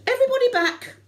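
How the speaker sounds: very high, loud command; screamed order. Retold or imitated. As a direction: bark it at the whole beach. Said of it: "screamed out".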